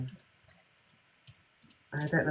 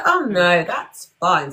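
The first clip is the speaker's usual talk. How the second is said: loud mock-nan reassurance; acted-out 'oh no' protest; nan's breezy dismissal; 7 dB louder, slower than usual. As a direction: wave it away loudly, imitating nan.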